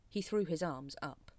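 plain reading voice

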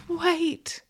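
high-pitched, tentative "wait"; a high little attempt to stop someone approaching; pleading attempt. A narrator's reading. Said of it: "you attempt as I walk towards you".

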